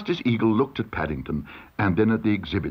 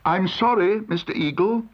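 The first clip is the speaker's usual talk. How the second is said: higher, apologetic call; Paddington calling out; 9 semitones higher than usual. A narrator's reading. Paddington apologises to Mister Eagle, calling out. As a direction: call up politely to the judge. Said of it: "called Paddington".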